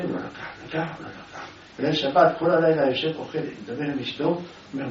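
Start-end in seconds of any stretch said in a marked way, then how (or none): 0.0-1.5 whisper. Acted. Secret: God didn't take it.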